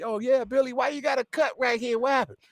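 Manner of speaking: put-on high teasing kid voice; friends asking. Friends asking Billy about his cut, high childlike voice. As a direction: pitch it way up like curious kids. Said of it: "they were like".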